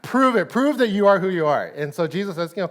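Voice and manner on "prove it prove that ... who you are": higher, louder teasing prove it; playful dare; mock challenge. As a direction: needle him like a cocky Peter.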